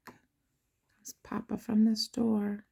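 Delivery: slow hushed whisper; slow, secretive whisper; slow whispered aside about Papa coming home. Acted.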